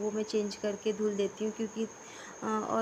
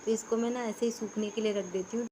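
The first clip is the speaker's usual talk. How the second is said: normal talking voice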